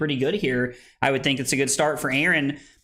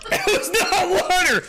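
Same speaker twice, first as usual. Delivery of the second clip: high squeal through real laughter; laughing protest; 13 semitones higher, 3 dB louder than usual. Real, not acted. Drinker finds out it wasn't water, laughing protest. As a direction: protest while cracking up.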